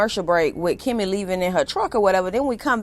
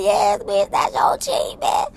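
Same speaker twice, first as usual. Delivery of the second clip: happy cheer; much slower than usual. Retold or imitated. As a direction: hype her up like a best friend. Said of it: "I'm all happy for Kimmy".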